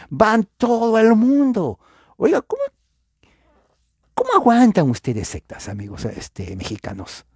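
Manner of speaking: exasperated outburst. Real, not acted. Preacher to Mexican brothers about the sects, exasperated high outburst. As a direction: throw up your hands at it.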